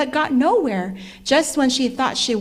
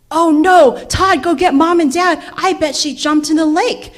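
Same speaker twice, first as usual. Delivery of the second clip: loud, higher alarm; 5 semitones higher, 8 dB louder than usual. A narrator's reading. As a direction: raise the alarm at once. Brother realizes sister jumped in.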